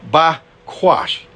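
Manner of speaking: bakwash sounded out slowly as bahk-WAHSH; pronouncing Hebrew. Shown as a demonstration. Preacher shows listeners a Hebrew word, careful pronunciation. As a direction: say bakwash as two careful syllables, bah-kwahsh. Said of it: "That's just the way I pronounce it".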